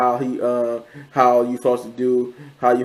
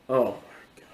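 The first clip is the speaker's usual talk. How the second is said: acted-out whisper of dawning horror; hushed, voiceless mock disbelief; stunned whisper; 7 dB quieter than usual. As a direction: whisper it, as if it just sank in. Retold father's realization about his brother.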